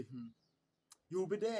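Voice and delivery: ordinary talk, nothing marked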